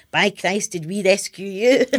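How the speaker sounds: mum's retort breaking into laughter; mock-indignant cry tipping into a laugh; imitated exclamation. Birth mother aside, daughter quoting adoptive mum's phone reply, mock outrage with a laugh.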